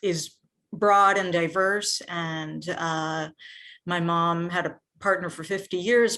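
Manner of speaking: ordinary talk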